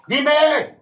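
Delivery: sharp, loud "¡Dime!" at full force; shout. Real, not acted. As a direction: demand an answer, shouting. Berating an addict's family. ("¡Dime!" gritado con fuerza)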